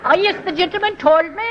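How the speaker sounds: Irish brogue. Shown as a demonstration. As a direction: demonstrate an Irish brogue for copying. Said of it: "make it a good brogue".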